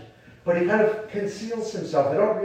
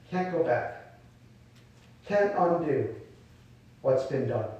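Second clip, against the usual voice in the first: "Can't go back." dropped much softer, falling; quiet answer; 4 semitones lower, 7 dB quieter than usual. Real, not acted. Preacher answering the believer's plea, softly and firmly. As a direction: let it land hushed and final.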